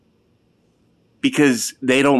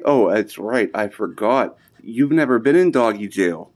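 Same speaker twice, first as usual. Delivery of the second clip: ordinary delivery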